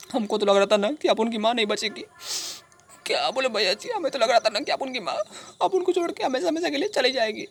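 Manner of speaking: high, strained, weeping voice; tearful wail. Acted. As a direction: wail as if losing your mother. Recalls fearing mother would die.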